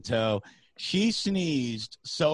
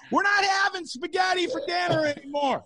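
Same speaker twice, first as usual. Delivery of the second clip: put-on high shouted panic call to the girlfriend; mock scream. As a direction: yell it across the store in panic. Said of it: "I screamed to my girlfriend".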